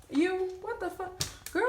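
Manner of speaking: very high, slow what the fuck; drawn out and shocked; outraged disbelief. Retold or imitated. Reacting to the girl's betrayal, high slow outrage.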